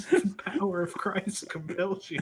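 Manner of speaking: mock exorcist. Exorcising a friend over a mispronounced word, mock-zealous. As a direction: cast out the demon in playful alarm.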